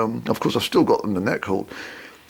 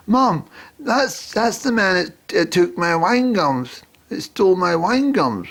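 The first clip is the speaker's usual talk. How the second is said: put-on high, whiny little girl voice; 8 semitones higher, slower than usual. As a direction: play a tattling child, pitched higher. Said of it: "this girl say".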